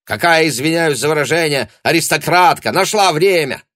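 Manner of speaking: high, loud, indignant sneer; sudden shouting. A narrator's reading. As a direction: burst out yelling in irritation. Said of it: "вдруг закричал он".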